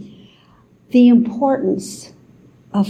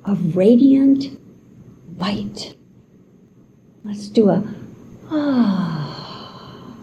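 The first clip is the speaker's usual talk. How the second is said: whispered breath cues in a meditation; guided meditation. Acted.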